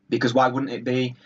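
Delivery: ordinary talk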